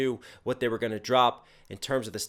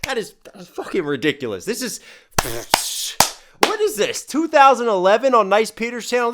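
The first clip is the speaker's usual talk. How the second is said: normal talking voice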